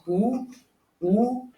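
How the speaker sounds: "U" as a pure "oo", no y-glide; German U. Shown as a demonstration. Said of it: "a U is normally pronounced".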